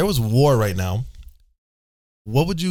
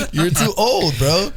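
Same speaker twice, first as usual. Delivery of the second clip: laughing; slower than usual. Real, not acted. Laughing at friend dodging draft.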